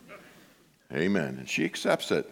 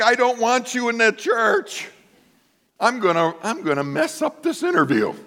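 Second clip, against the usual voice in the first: higher, louder playful voice of God; voicing God; 13 semitones higher, 3 dB louder than usual. Pastor voicing God sabotaging his interview, playful higher voice. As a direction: play God with mock mischief. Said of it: "God's like".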